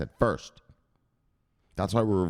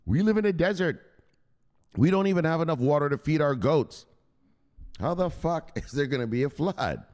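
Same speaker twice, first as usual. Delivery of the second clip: higher jeering street voice; mocking neighbors; 6 semitones higher than usual. Neighbors mocking Noah, a touch of high ridicule. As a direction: laugh him off, high and scornful. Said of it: "everyone around him laughed at him like".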